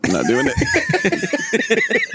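slow, laughing refusal. Real, not acted.

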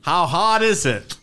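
high, drawn-out mock Boston accent. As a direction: laughingly show off a broad Boston accent. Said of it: "Overdub Kevin Costner's accent".

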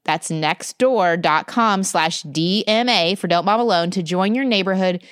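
polished, measured podcast ad delivery; host-read promo with a lilting pitch; sponsor ad read. Real, not acted. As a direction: sell it warmly to listeners, clearly enunciated.

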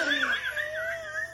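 high, wheezing laugh; helpless laughing fit. Real, not acted. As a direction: crack up at a funny memory, high and breathless. Amused by husband's burning-smell question.

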